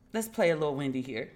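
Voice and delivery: usual speaking voice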